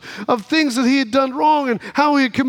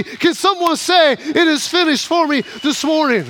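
raised, celebratory preacher's voice; fired-up preaching; 3 semitones higher than usual. Real, not acted. Preaches at full force.

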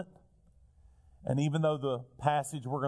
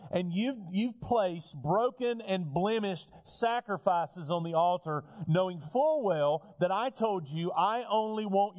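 higher, driving sermon voice, voicing God's accusations; full-force preaching; 5 semitones higher than usual. Pastor recounting God's charges to the congregation, preaching hard. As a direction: preach it with rising fire.

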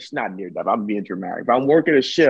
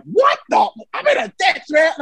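retold incredulous outburst; 11 semitones higher than usual. Retold baffled outburst at police.

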